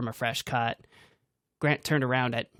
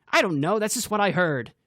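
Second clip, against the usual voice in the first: louder, higher snapping reply; defensive snap; 6 semitones higher, 5 dB louder than usual. A narrator's reading. Grant snapping back at the group, loud. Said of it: "Grant snapped back".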